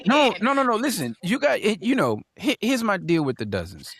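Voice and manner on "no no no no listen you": high, loud burst of objection; insistent interrupting. Real, not acted. Host cutting in on guest about the dozens, insistent. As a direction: break in high and forceful.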